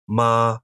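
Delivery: MA held high and level; high level first tone. Shown as a demonstration. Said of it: "pronounced high and level".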